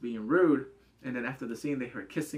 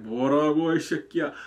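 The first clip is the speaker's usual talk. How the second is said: louder mock snarl in Korean; hammed-up threat at the guy; 화난 듯한 장난스러운 호통; mock angry retort; 8 dB louder, much faster than usual.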